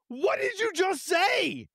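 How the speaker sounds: high, stunned what did you just say; shocked outburst. Real, not acted. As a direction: blurt it out, appalled. Reacting to a slur.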